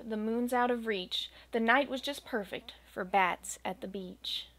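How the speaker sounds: usual speaking voice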